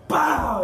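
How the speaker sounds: a loud, high cartoon pow; pow sound effect. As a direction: slam it out like a punch landing. Knocking the dope everywhere.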